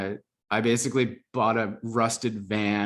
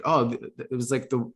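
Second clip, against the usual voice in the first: normal talking voice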